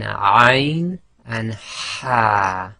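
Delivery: ain and ha drawn out very slowly from the middle of the throat; throat letters ain and ha, very slow. Shown as a demonstration. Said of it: "So again".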